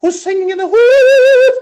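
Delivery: a Welsh choir teacher calls out again, singing; Welsh accent. Retold or imitated. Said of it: "So she had an accent".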